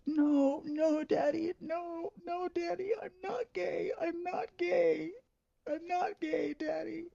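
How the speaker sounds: put-on very high, wailing childlike begging; sobbing plea. Son sobbing and begging his dad to believe him, wailing. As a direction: sob out no Daddy as if breaking. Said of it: "through sobbing".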